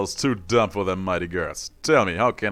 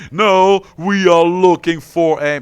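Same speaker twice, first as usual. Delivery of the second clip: louder, higher, slow fed-up guard; exasperated guard; 8 semitones higher, 8 dB louder, slower than usual. Acted. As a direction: start correcting him, losing patience. Guard cut off, annoyed.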